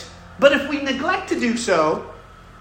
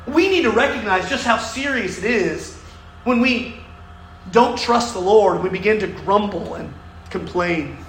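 ordinary talk, nothing marked

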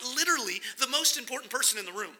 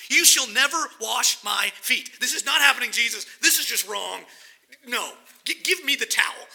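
louder, insistent protest; frustrated Peter; 6 dB louder than usual. Retold or imitated. Peter refusing to let Jesus wash his feet, frustrated and loud. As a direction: refuse Jesus outright, exasperated. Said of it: "he's frustrated".

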